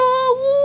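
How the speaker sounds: high whoop into laughter; shrieking laugh. Real, not acted. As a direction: squeal then laugh. Falls and shrieks in game.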